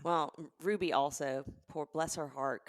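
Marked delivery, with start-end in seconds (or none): none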